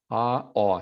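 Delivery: "Ah" open and "aw" rounded, said carefully; vowel contrast. Shown as a demonstration. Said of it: "You hear that?".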